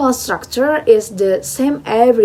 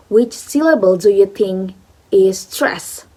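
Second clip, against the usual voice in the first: normal talking voice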